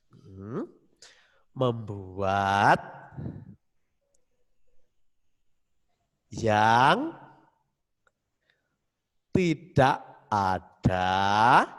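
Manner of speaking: very slow, long pauses between words; very slow dictation. Shown as a demonstration. Teacher re-dictating very slowly to a crying pupil. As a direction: drag each word out for slow writers. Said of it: "Sekali lagi, Mister bacakan ya".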